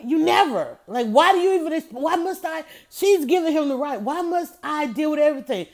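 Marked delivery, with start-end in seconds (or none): 0.0-2.6 a touch higher, wife tearing into her husband; mock scold, exasperated. Wife lighting into husband without wallet, exasperated scolding. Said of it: "she lit into him so bad". 4.0-5.7 scolding husband. Retold or imitated. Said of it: "she lit into him so bad".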